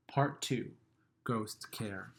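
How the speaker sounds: ordinary delivery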